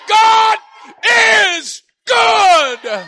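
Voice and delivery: high, loud cry, breaking on good; tearful shout. Real, not acted. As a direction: cry it out with everything. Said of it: "you need to say it again".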